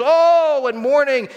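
high, loud, hammed-up lamenting; mock mourners' wail. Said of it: "all the mourners".

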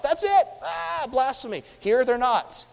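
high, loud mock accusation; hammed-up horrified outburst; acted outrage. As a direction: cry out like a scandalized accuser. Acts out outraged accusers at trial.

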